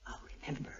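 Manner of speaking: soft unvoiced whisper; whispered promise. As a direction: breathe it out tenderly, barely audible. Tender whispered promise to husband.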